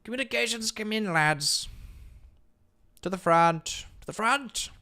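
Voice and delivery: rally the troops like a pompous British officer; booming high posh British call to the front; posh British commander. Pretend. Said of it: "He does have kind of British".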